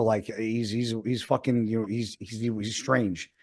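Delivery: quiet, halting, unsure mumble; hesitant mumble. Retold or imitated. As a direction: trail off nervously like a kid who doesn't want trouble. Gym kid unsure about stranger.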